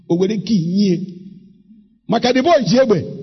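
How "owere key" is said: Owere key stretched out slowly, each word drawn long; slow emphasis. Shown as a demonstration. Preacher repeating the words to the congregation, slowly for emphasis. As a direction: drag out Owere key for weight. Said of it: "Owere key police commissioner".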